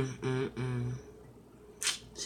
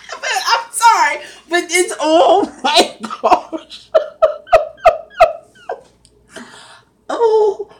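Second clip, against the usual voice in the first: loud, high laughing apology; trying to apologize through laughter; laughing too hard to talk; 10 semitones higher, 9 dB louder, slower than usual. Real, not acted. Host laughing at the story.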